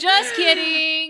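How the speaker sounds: laughing loudly through the words. Real, not acted. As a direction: burst out laughing while saying it. Laughing after joke.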